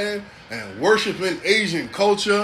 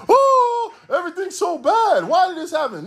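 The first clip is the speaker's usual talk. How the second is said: high-pitched whining lament; mock wailing; 12 semitones higher than usual. Comedian imitating people crying to God in calamity, high mock wail. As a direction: put on a much higher, woeful wail.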